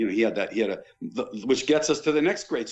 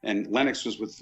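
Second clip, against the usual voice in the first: ordinary talk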